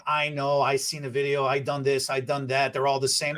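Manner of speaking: mock know-it-all. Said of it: "don't skip out and say".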